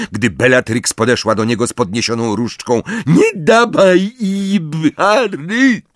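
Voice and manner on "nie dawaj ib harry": much higher, slow desperate roar; Neville roaring. A narrator's reading. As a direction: scream the warning. Neville keeps shouting. (rozpaczliwy, wysoki ryk Neville'a)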